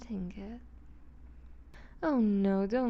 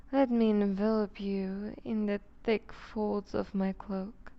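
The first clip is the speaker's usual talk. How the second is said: ordinary talk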